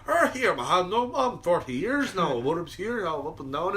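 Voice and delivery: garbled mock Irish mumbo-jumbo; unintelligible old Irishman. Old patron answering reporter, garbled Irish accent. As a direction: jumble the words in a thick Irish brogue. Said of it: "didn't hear understand a thing that old man said".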